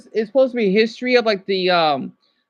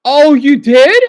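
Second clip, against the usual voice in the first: loud, raised-pitch surprised question; real surprise; 4 semitones higher, 12 dB louder, slower than usual. Real, not acted. Streamer answering news in the chat, loud surprise. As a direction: react loudly, taken by surprise.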